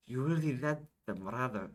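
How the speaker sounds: put-on character line begun in the wrong accent; Isabel's line in a mistaken accent. Isabel praises Yashish, in the wrong accent. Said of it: "wrong, wrong accent".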